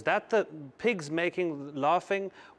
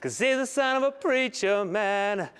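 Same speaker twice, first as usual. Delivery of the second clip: higher, sing-out delivery; theatrical, raised-pitch proclamation; hammed-up showy announcement; 6 semitones higher, 3 dB louder than usual. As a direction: play it up like a preacher's revival line. Continues performed preacher-man drink routine.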